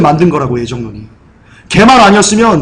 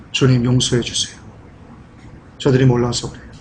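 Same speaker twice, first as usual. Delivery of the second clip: softer, lower, gentle plea; tender prayer; 6 semitones lower, 9 dB quieter than usual. Acted. Jesus praying for those who crucify him, soft and gentle. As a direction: forgive them softly, like a dying prayer.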